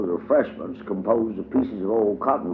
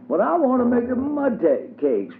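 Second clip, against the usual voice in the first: put-on insistent child. A narrator's reading. Dubs persists with Diddy about the mud cakes, high insistent child voice. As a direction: dig in and insist like a stubborn child. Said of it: "persisted Dubs".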